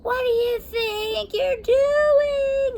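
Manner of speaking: high, slow menacing witch. A narrator's reading. Witch Beryl confronts the girl holding the necklace. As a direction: snarl it as a threat.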